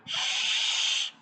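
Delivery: long unvoiced shh; shushing sound. Shown as a demonstration. Teacher showing pupils the quiet-time sound, long shh. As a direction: hush like calling for quiet. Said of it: "like this sound".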